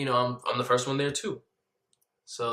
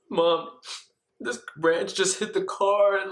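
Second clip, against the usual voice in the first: fake hysterical; 7 semitones higher, slower than usual. Son lying to mom by phone, acted hysteria.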